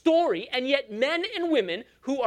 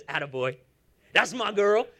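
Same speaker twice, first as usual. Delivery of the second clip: fond God voice; 5 semitones lower than usual. Acted. Preacher voices God affirming believer.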